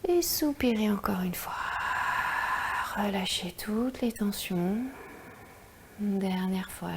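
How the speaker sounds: soft whispered breathing guidance; slow, hushed meditation voice; guided meditation. Acted.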